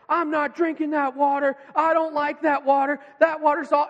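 loud, high mock child tantrum; hangry outburst. Dad imitating his hungry son refusing spilled water, hangry outburst. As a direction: refuse like an angry five-year-old. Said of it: "he was hungry and angry".

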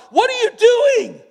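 high, loud startled yell at the strangers; a shocked shout from the truck cab. Retold or imitated. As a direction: yell it out in alarm. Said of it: "he just yells".

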